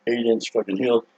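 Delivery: ordinary delivery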